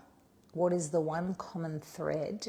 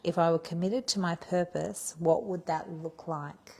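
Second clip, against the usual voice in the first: louder, measured read of the prompt, slowing on the second half; announcing the prompt; 5 dB louder than usual. Acted. Coach announces the day's writing prompt, a measured, louder read. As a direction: present the prompt to the group with weight.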